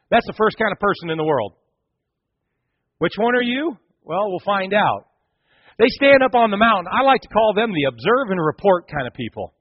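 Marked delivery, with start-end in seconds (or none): none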